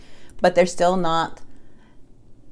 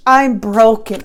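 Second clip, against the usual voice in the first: higher, louder mock-pitiful whine; 5 semitones higher, 8 dB louder, slower than usual. Reader mocking the ex's pitiful message to Aquarius, mock whine. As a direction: parrot the sad message back in a whiny mock voice.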